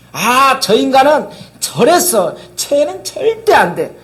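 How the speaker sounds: high, drawn-out mock condemnation; judgmental complaint. Preacher mimicking church members judging someone who wronged them, judgmental complaint.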